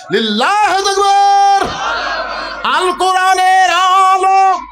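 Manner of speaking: high, loud, long-drawn rallying cry; slow chanted shout for a crowd to answer; slogan shouting. Real, not acted. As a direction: lead the crowd, holding each slogan out.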